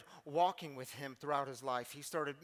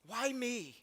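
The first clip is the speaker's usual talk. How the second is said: a high, pained cry to his father; burned boy's plea; 6 semitones higher, much slower than usual. Retold or imitated. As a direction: plead like a hurt child asking why. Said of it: "he's looking up at his father, and he says".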